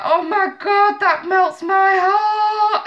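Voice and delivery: high, slow sing-song gush. Retold or imitated. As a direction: melt over it, singing it out. Gushing about child's I love you.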